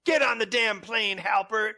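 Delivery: high, loud mock shout of an order; imitating a boss. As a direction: yell it like an exasperated CIA boss. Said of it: "Jim Halpert's boss at the C I A, and he's like".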